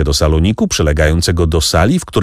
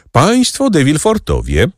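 higher-pitched servant's announcement; announcing call; 10 semitones higher than usual. A narrator's reading. Servant announces guests to the salon, calling out. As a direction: call out the arriving guests' names. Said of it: "zawołał baptysta".

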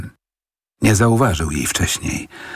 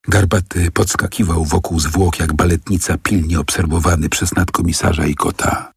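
ordinary delivery

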